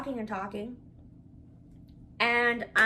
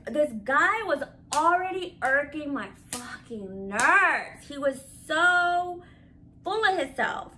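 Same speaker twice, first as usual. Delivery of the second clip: exasperated; 4 semitones higher, 6 dB louder, slower than usual. Real, not acted. Exasperated about her date.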